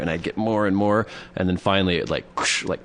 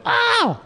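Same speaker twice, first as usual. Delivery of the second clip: very high overdone yelp of pain; exaggerated yelp. Girlfriend barely squeezed, overdone high yelp. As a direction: yelp like it really hurt. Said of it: "they go".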